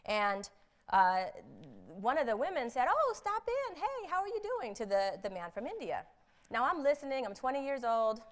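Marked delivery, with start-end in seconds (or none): 2.9-4.7 high, bright-voiced friendly hello; mock chirpy office greeting; cheery colleague greeting. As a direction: greet him like an old friend, much higher.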